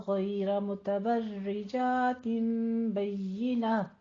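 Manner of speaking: slow, drawn-out tajweed chant; Quran recitation. Acted. Teacher recites the verse for her students, slow melodic chant. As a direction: recite the verse melodically, stretching each vowel.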